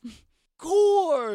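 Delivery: high, loud, stretched-out grande dame; theatrical ghost madam. Acted. Ghost grants the party her portal, grandly theatrical.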